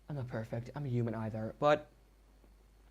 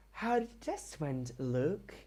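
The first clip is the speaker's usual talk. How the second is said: teacher's odd voice; 3 semitones higher, slower than usual. Retold or imitated. Imitating the teacher's weird voice asking about the test. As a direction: pitch it way up like the creepy teacher. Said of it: "talked with a completely different voice".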